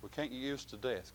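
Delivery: ordinary talk, nothing marked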